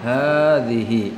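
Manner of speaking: hazihi slow with each syllable clear; teacher contrasting hadza and hazihi. Shown as a demonstration. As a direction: spell out hazihi by sound. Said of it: "Hadza, hazihi".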